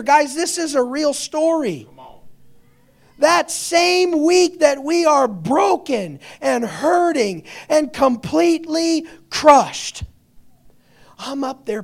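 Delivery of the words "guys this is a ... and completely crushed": loud, high, slow pulpit cry; preaching at full force. Real, not acted. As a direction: pound each phrase out to the congregation.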